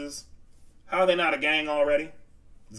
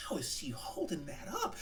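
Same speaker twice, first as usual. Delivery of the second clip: much softer, almost breathed; quoted disbelief; 3 semitones higher, 15 dB quieter than usual. Retold or imitated. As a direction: say it under your breath in amazement. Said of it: "I was like".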